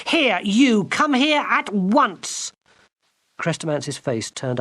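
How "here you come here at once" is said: high, slow commanding shout; girl shouting down at the enchanter. Acted. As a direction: bark orders down the stairs. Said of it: "Gwendolen shouted down at him".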